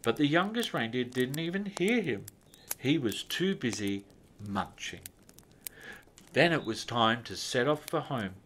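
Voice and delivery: ordinary delivery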